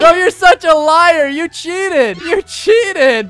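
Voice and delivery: high, loud, drawn-out protest; indignant accusation. Real, not acted. Player accuses rival of cheating, indignant.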